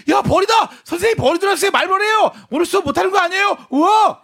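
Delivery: high, loud classroom uproar; excited shouting. Retold or imitated. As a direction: shout it like rowdy kids. Students shouting about the hornet.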